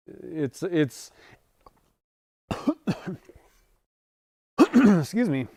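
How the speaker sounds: coughing through words; just choked on a sip of water; choking on water. Real, not acted. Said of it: "Now I'm choking on my water".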